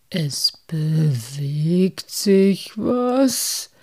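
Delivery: slow, drawn-out pondering question; frowning, stretched-out echo; puzzled repeating. A narrator's reading. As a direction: repeat it slowly, weighing every word. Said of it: "wiederholte Moosbart und legte die Stirn".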